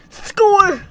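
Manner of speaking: very high, loud outburst; triumphant shout. Real, not acted. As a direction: yell it out in triumph. Celebrating a coal find.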